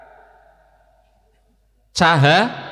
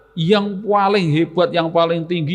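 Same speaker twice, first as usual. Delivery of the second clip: ordinary delivery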